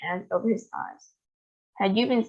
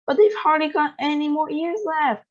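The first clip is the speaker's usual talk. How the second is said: high, raised lament; distressed wail; 8 semitones higher than usual. A narrator's reading. Grandpa wailing about the shrinking old folks. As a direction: wail in despair. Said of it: "wailed Grandpa Joe".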